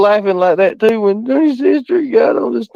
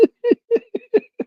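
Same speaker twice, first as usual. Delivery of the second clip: helpless high-pitched giggle; breathless shriek of a laugh; high squealing laugh; 4 dB quieter than usual. Real, not acted. Streamer cracking up at chat.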